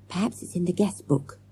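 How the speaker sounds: hushed, secretive whisper. A narrator's reading. Boy sneaking in the lobby with friends, whispering. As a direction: whisper the suggestion softly. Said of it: "whispered Morgan".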